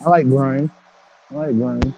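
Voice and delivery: muffled, like through a wall. Real, not acted. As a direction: voice muffled like in a trunk. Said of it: "you sound muffled as hell".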